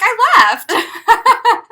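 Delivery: high, loud laughing delivery; laughing punchline. Real, not acted. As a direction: burst out with it laughing.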